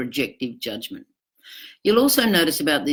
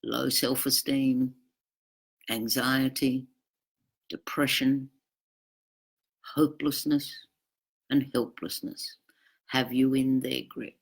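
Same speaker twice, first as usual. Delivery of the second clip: whisper; 2 semitones lower, 5 dB quieter than usual. Real, not acted. Listing damage done.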